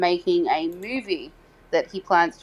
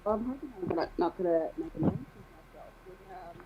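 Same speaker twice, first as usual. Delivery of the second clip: muffled, as if through a pillow; 5 dB quieter than usual. Real, not acted. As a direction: talk with a pillow over your mouth. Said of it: "Get the pillow off your face".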